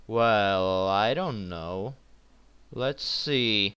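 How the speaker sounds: hesitant. Pretend. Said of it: "plays out something like this".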